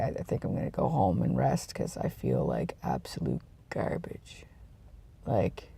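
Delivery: weary whisper, feeling awful; a tired whisper to the camera. Real, not acted. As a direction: breathe it out, sick and drained. Sick, feeling like garbage.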